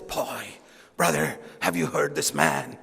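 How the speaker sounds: low, hushed voice of an impressed churchgoer; mock awed admirer. Impressed believer telling a brother about a preacher, mock awe.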